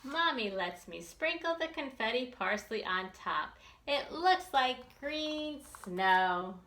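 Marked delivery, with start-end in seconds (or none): none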